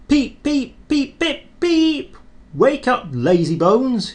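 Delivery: high, loud cheeky little engine teasing; cheeky whistle. A narrator's reading. Cheeky engine whistling to wake dozing Gordon, high and teasing. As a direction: peep like a cheeky little engine waking a big one. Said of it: "he would whistle".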